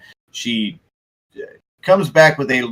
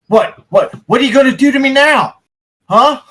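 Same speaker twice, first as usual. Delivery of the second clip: high, loud challenge; angry, alarmed Coulson; 11 semitones higher, 5 dB louder than usual. Acted. Cornered man confronting those who betrayed him, angry and alarmed. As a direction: demand an answer, pitched high. Accused NPC confronts the party.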